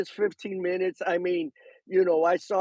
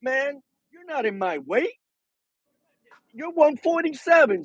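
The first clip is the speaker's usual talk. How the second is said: dismissive boxer; 8 semitones higher than usual. Retold or imitated. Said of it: "he looks at him and he says".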